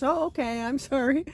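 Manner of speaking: high, apologetic oh okay; apologetic young guy. Retold or imitated. Young guy backing off, high apologetic voice. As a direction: lightly voice his apology.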